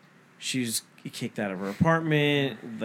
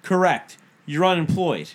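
louder, cutting put-down; biting retort; 6 semitones higher, 6 dB louder than usual. Retold or imitated. Retelling the argument with the neighbor, louder biting retort. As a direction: slam the verdict down.

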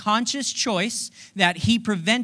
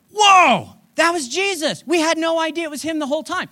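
stunned whoa; 7 semitones higher, 4 dB louder than usual. Retold or imitated. Disciples stunned by Jesus.

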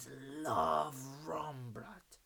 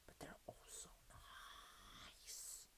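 whispered aside, barely voiced; 22 dB quieter than usual. Real, not acted. Friend praising cocktails in a secret whisper.

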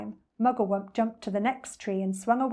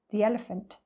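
ordinary delivery, nothing marked

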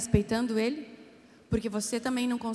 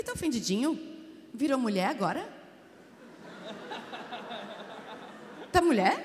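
teasing mockery; 3 dB quieter than usual. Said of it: "Eu comecei a rir".

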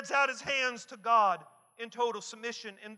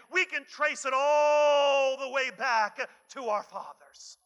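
louder, rising preacher's cry; full-force preaching; 2 semitones higher, 6 dB louder than usual. Acted. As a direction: drive it home like a revival sermon.

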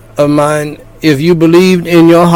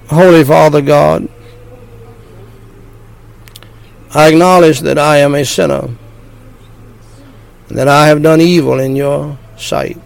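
short phrases with pauses. Shown as a demonstration. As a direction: pause after each phrase for repeating. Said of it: "phrase by phrase".

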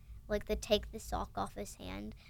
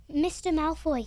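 a mild gasp, higher and louder; 6 semitones higher, 7 dB louder than usual. A narrator's reading. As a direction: call it out as if a little winded. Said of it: "he gasped".

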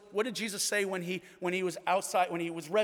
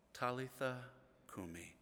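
tender whisper-soft call; 5 semitones lower, 11 dB quieter than usual. Retold or imitated. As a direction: wake a sleeping child gently. Jesus wakes the girl softly.

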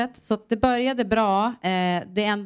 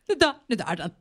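higher, fast, with a rueful laugh; breaking into a laugh at the loss; laughing through it; 7 semitones higher, faster than usual. Real, not acted. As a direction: laugh off the sad thought. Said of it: "så himla sorgligt".

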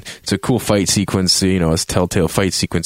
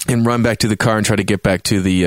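ordinary talk, nothing marked

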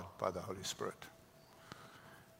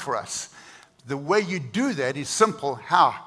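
louder, climbing preacher's build to the cross; full-force preaching; 5 semitones higher, 13 dB louder, slower than usual. Acted. Preacher urging the church to let the cross work, full force.

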